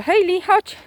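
high, loud call to the dog. Real, not acted. Calling the dog. (wołanie psa wysokim głosem)

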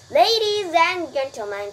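booming fair announcement; loudspeaker announcer. A narrator's reading. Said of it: "continued the loudspeaker".

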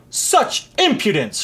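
high, slow indignant outburst; outraged king; angry shout. A narrator's reading. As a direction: draw it out in royal outrage. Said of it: "shouted the king".

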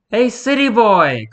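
put-on high, loud, drawn-out girl's call; slow, teasing young girl's shout. A narrator's reading. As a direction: hail the newcomer from across camp. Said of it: "called a girl about his age".

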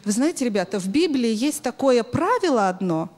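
usual speaking voice

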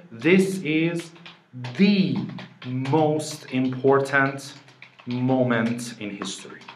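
slow, weighty "this is THE most important moment"; emphatic THE. Retold or imitated. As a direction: punch THE as "thee" before "most important".

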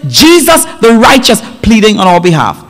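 high, ringing sermon delivery; impassioned preaching. Acted. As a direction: preach it with mounting fervor, pitch lifted. Preaches the verse with rising force.

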